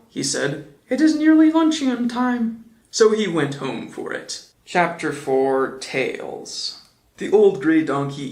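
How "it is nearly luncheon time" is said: a high, light little bear voice; Pooh voice. A narrator's reading. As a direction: pitch it up as a simple bear. Pooh's voice.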